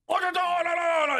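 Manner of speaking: high loud nonsense yelling, hammed-up; mock Japanese yelling. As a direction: yell gibberish like a cartoon samurai. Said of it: "they're just yelling things".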